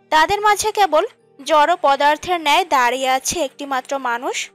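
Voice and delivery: ordinary talk, nothing marked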